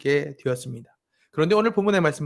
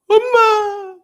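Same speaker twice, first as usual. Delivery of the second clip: much higher, louder crying call; imitating a crying child; 11 dB louder, much slower than usual. Imitating the daughter wailing for mom as dad opens her eyes. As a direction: wail it like a scared child.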